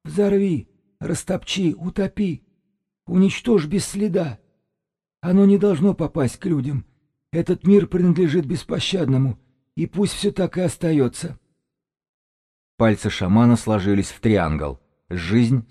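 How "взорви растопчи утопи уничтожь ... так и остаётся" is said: high-pitched whistling through the throat; eerie shaman piping his orders high; high, thin throat-whistle voice; whistling throaty shaman. A narrator's reading. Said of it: "тихонько просвистел горлом шаман".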